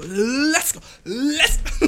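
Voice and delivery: cracking up with hyper glee; giddy hype. Real, not acted. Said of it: "Ich drück auf Let's go".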